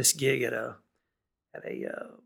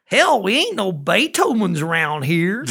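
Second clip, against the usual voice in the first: laughing yokel; 5 semitones higher, 9 dB louder, slower than usual. Retold or imitated. Patron's reply, laughing.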